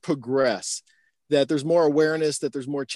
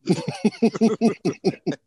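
laughing; 4 semitones higher than usual. Real, not acted. Laughs at own pun.

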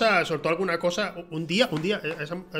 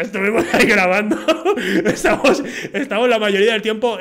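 talking through laughter; real laughter, louder; laughing; 4 semitones higher, 7 dB louder than usual. Real, not acted. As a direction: laugh while you tell it. Laughing while telling.

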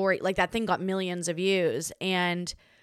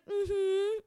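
fake polite tone; 5 semitones higher, much slower than usual. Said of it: "Like, I was like".

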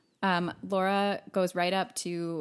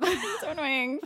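ordinary talk, nothing marked

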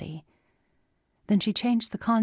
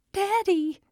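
put-on much higher, scared child's call; frightened cry; 3 dB louder than usual. A narrator's reading. Little girl calling her father while starting to cry. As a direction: call for help on the verge of tears. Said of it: "Steph started to cry".

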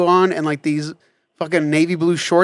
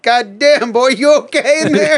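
louder and higher, cracking up through it; laughing mock shout; 8 semitones higher, 5 dB louder, slower than usual. Friends calling through the bathroom door, laughing and loud. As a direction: half-shout the question while laughing. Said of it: "and they're like".

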